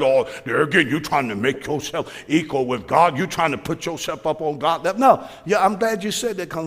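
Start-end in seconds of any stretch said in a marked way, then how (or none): 0.0-5.0 a hint of a scolding churchgoer, lower; tut-tut at the proud believer; religious critic. Preacher voices religious critics scolding believers, mild scold. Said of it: "religion don't want you to think like that".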